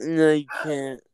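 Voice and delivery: ordinary delivery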